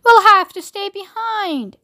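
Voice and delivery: slow, high mournful cry; drawn-out disappointed lament; wailing. A narrator's reading. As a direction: wail it as if all is lost. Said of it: "wailed Grandma Georgina".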